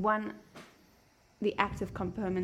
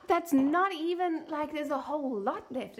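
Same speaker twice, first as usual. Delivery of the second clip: high, slow, shocked protest; indignant gasp; 8 semitones higher, 3 dB louder than usual. Real, not acted. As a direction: gasp in disbelief at the glass. Indignant over an unfinished drink.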